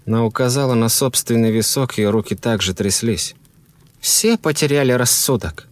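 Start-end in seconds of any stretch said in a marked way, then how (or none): 4.0-5.7 high, insistent woman's voice; shaken wife. A narrator's reading. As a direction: argue in a high voice, stumbling slightly.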